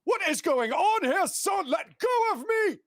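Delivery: very high, loud panicked protest; a pinned courier shouting to be freed; panicked gentleman. Acted. Courier yelling at the man pinning him down, very high.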